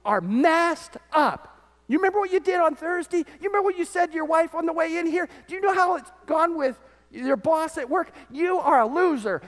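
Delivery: high, taunting accuser's voice; accusing Satan. Retold or imitated. Satan taunting a churchgoer, high and mocking. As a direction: jeer at him like the devil. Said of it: "Satan's whispering in your ear".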